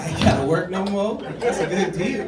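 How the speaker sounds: half tempted by the offer, cracking up; laughing delight. Real, not acted. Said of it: "laughing at the idea".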